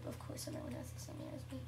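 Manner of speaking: quick, very soft aside; hushed, hurried self-correction; muttered retraction. Real, not acted. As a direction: take it back under your breath. Takes back the mock complaint.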